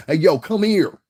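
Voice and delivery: loud, high holler across the street; street-style "yo" shouted from afar; calling out. Retold or imitated. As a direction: call somebody over, loud and high. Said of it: "motherfuckers be like".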